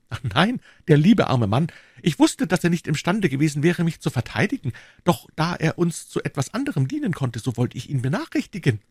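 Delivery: put-on high young lady's voice, pitying her husband; high-pitched, sympathetic lady; Madame Bonacieux. A narrator's reading. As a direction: voice a young wife in a high register.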